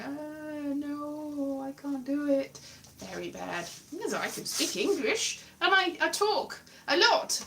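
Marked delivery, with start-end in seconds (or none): 0.1-2.6 small, soft, higher nervous whine; mock nervous. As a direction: act out timid refusal, lightly and quietly. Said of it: "being very nervous and going".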